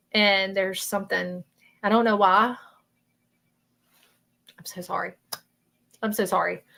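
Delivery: real choked-up apology, halting; trying to keep composure; getting emotional. Real, not acted. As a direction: hold back tears, pausing between phrases. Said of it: "I'm sorry I got emotional".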